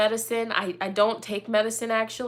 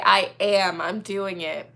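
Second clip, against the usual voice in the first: ordinary talk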